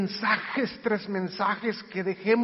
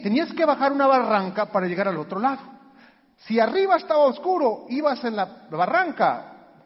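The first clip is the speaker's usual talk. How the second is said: usual speaking voice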